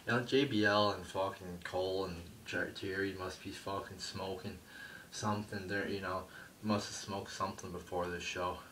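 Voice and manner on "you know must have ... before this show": usual speaking voice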